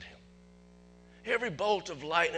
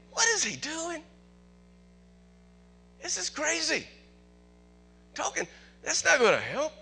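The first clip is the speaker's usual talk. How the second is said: high, incredulous disciples; lightly voiced doubters asking what he's doing; disciples doubting; 10 semitones higher, 4 dB louder than usual. Disciples in the boat doubt Jesus, incredulous high voice. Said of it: "they're thinking".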